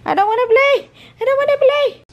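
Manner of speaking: squeaky high pretend dog voice; voicing the dog. Mom voicing the dog's refusal in a squeaky high voice.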